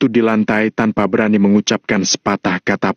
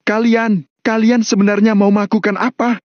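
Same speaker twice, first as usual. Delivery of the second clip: high-pitched alarmed question; a touch of panic, pitched high; frightened; 11 semitones higher than usual. Acted. Captive John asks the gang their plans, starting to fear. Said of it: "akhirnya mulai merasa takut".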